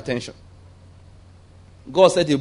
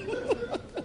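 high laugh; 14 dB quieter than usual. Acted. Laugh after God's line.

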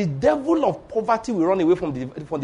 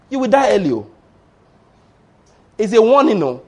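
raised, loud warning cry; 3 semitones higher, 5 dB louder than usual. Real, not acted. Preacher warning his congregation, loud and raised. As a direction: shout the warning at the church.

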